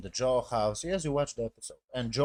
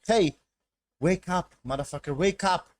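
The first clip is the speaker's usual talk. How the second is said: raised, higher voice, rousing someone; urgent wake-up call; 6 semitones higher, 4 dB louder than usual. Retold or imitated. Girl trying to wake Joel, mock urgent call. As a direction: imitate someone urgently waking a sleeper. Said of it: "try to wake him up".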